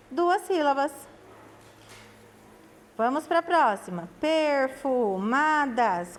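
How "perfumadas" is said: "Perfumadas" slowly stretched out; slow, connected syllables in "Perfumadas"; syllables drawn out. Shown as a demonstration. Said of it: "Cada parte da palavra".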